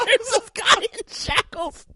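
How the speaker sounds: high, slow, helpless laughing speech. Real, not acted. Storyteller cracks up at the convict in shackles, laughing. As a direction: squeeze the words out through real laughter.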